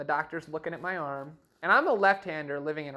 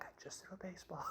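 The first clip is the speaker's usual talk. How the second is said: quick, much softer, whispered; sheepish whisper; 3 semitones higher, 20 dB quieter, faster than usual. Retold or imitated. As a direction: whisper it like you're a bit embarrassed. Sheepish answer to doctor.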